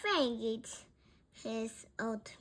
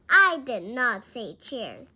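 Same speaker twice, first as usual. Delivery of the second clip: louder, emphatic correction; firm raised volume; 11 dB louder than usual. A narrator's reading. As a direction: correct them over the noise. Frank corrects Bean over noise.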